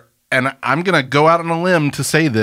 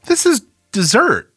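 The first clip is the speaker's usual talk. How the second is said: disbelieving outrage; 13 semitones higher than usual. Real, not acted. Outraged by dessert pancakes.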